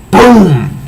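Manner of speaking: ordinary delivery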